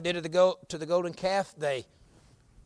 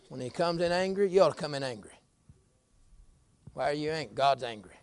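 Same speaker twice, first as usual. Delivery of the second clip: normal talking voice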